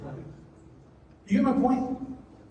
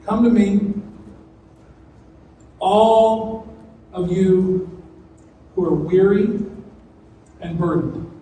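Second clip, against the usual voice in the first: solemn invitation; 2 semitones lower, 4 dB louder, slower than usual. Acted. Quoting Jesus slowly, louder.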